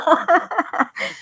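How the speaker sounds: high laugh through tears; embarrassed weepy laugh; tearful laugh. Real, not acted. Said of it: "getting emotional".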